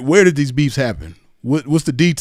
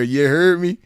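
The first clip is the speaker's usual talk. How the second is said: mock mimic; 3 semitones higher than usual. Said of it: "he said it right there".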